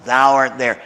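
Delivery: "thou" cut short and hit hard; emphatic thou. Shown as a demonstration. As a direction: punch "thou" abruptly. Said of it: "it's abrupt. It's emphatic".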